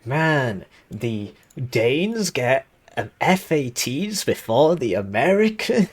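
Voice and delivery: high, drawn-out complaint with big pitch swoops; mock whiny commenter. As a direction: mimic an outraged commenter, dragging the words out. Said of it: "I can already hear the comment".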